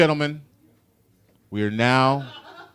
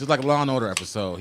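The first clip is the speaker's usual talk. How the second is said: ordinary delivery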